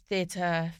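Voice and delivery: ordinary talk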